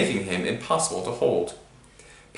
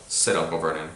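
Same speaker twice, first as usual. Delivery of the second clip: ordinary delivery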